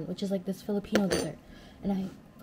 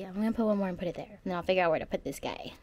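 fast; 2 semitones lower, much faster than usual. Real, not acted. Hurried aside.

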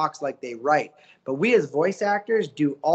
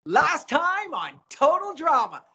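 high, slow host intro through a smile; smiling intro; 10 semitones higher, slower than usual. Shown as a demonstration. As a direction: smile hard and stretch out the show title. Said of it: "Can you just smile when you say it?".

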